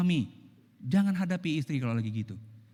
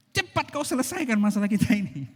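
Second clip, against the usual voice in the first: higher, louder hurried order; demanding wife; 6 semitones higher, 5 dB louder than usual. Acted. Wife pressing her husband to settle the problem, loud and high. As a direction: snap at him to fix it now.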